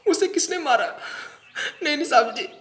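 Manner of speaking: high-pitched distressed disbelief; shocked. Acted. Suspect learns friend Raju was killed, shocked. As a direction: cry out at terrible news.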